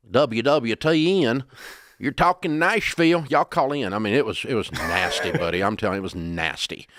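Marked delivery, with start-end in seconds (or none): 0.1-3.9 slow, loud, high mock hillbilly radio twang. Early radio host welcoming callers, hammed-up hillbilly twang. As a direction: ham up a Southern hillbilly accent for the callers. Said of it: "in a really heavy hillbilly twang".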